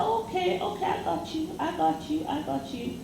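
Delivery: soft high soothing okays, fading away; calm reassurance. Retold or imitated. Retold woman calming the angry date, soft and soothing. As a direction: placate him gently. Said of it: "just calmly said".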